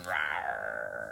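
low growly tiger rawr; tiger roar impression. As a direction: growl it like a big cat. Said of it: "Do you want to see my impression?".

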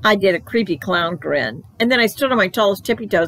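plain reading voice

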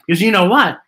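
ordinary delivery, nothing marked

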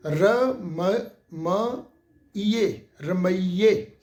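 ਰਮਈਏ split into ਰ, ਮ, ਈਏ and then joined again; syllable by syllable. Shown as a demonstration. Teacher breaking ਰਮਈਏ into syllables for students, slow. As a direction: spell out ਰ ਮ ਈਏ slowly, then say ਰਮਈਏ whole. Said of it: "ਇਸ ਢੰਗ ਦੇ ਨਾਲ".

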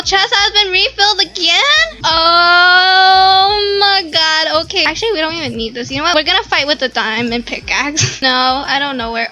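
ordinary talk, nothing marked

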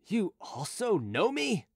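drawn-out outraged disbelief, voice rising high; mild rage. A narrator's reading. Chicot to a sentinel who knows him, mildly enraged. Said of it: "cried Chicot in a rage".

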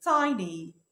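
tiny said as TY-nee with a long i, the correct way; correct pronunciation. Shown as a demonstration. A teacher shows students tiny the right way. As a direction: model tiny clearly with the long i sound. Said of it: "النطق الصح بتاعها".

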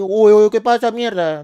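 fuerte y agudo, quejándose; reacción airada. Acted. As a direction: protesta con un uy exagerado. Réplica indignada al vecino. (queja airada y teatral, en voz alta)

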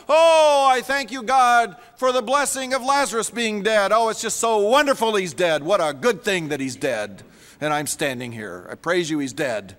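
sarcastic thanksgiving that deflates toward the end; mock rapture. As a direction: gush in fake gratitude, then let it drop away. Mock prayer Jesus never said.

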